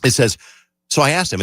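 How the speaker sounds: ordinary delivery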